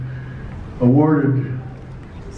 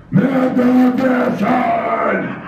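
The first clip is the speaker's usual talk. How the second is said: ring announcer hype; 9 semitones higher, much slower than usual. Pretend. Hyping a wrestler's ring name.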